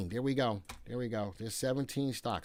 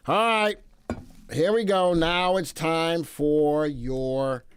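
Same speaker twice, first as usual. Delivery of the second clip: loud, high show-host build-up; pumped host intro; excited hype; 4 semitones higher, 6 dB louder, slower than usual. Acted. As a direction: announce the segment like a game show. Hyped segment intro.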